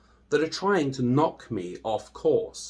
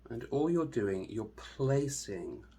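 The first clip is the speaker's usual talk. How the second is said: guided meditation; 7 dB quieter than usual. Acted. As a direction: ease the listener into stillness, hushed and unhurried.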